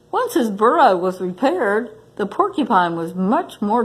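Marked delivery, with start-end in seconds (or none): none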